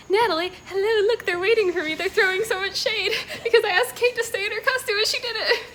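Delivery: high and loud, laughing through the words; bubbling over with giddy joy; a laughing, excited rush of words; giddy excitement. Real, not acted. Excited at home, friends waiting.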